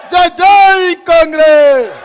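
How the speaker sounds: loud, slow జై, జై Congress with stressed జై; slogan shout. Real, not acted. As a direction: chant it to the crowd. Repeat of the slogan. (గట్టిగా జై, జై కాంగ్రెస్ అని నినదించు)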